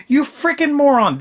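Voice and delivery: high-pitched, exasperated insult; exasperated outburst. Real, not acted. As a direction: scold him in sheer disbelief. Ranting at a book character.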